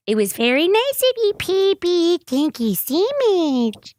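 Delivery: put-on high-pitched gushing baby talk; sugary high pet-talk voice; cooing thanks. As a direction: coo high at a cat, drawing out the words. Mock thanks to the cat.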